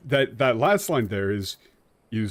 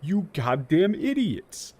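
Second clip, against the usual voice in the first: rip into the translators; exasperated rant; 5 semitones higher than usual. Real, not acted. Berating the translators over a phrase in an exasperated rant.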